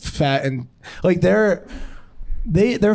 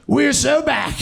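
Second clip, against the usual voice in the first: high, hammed-up triumph; mock triumphant cheer; 10 semitones higher, slower than usual. Comic jokes about going back in time, high mock cheer. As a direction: crow it high like a victory slogan.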